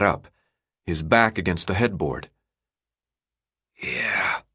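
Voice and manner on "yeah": soft raspy mutter; scratchy croak. A narrator's reading. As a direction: croak it, weak and sore. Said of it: "he muttered, a scratchy croak".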